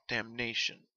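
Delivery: ordinary delivery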